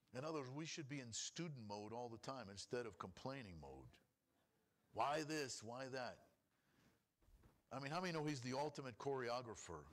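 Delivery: ordinary delivery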